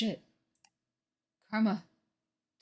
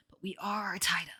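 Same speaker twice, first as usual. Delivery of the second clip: whisper; 6 dB quieter than usual. Real, not acted. Aside about being tied up.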